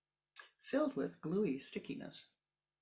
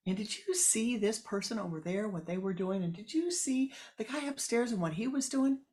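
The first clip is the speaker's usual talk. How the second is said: loud, higher, fretful rant; complaining woman; 4 semitones higher, 5 dB louder than usual. Acted. The seized woman complains loudly to her neighbor. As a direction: pour out grievances loudly.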